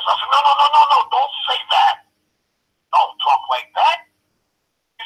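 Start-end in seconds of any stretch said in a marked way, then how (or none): 0.3-4.1 higher-pitched, rapid-fire no's slowing into firm advice; urgent objection. Retold or imitated. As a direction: cut him off with an urgent retold protest. Retells urgently stopping boxer's insult.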